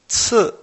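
"Zi" starting high and dropping sharply; teacher's crisp falling "Zi"; fourth tone. Shown as a demonstration. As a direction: model "Zi" as a Mandarin fourth-tone syllable. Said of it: "cuarto tono".

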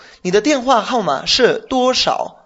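careful, slow demonstration of the question; measured teacher's read, each syllable set apart; slow model sentence. Shown as a demonstration. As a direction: say it clearly so the student can repeat. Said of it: "Exacto, perfecto".

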